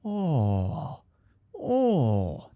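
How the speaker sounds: long drawn-out "oh" as mock Chinese; mock Chinese accent. Comedian contrasting with his Irish noise, mock Chinese accent. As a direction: caricature a Chinese accent in a single "oh". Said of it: "that's Chinese".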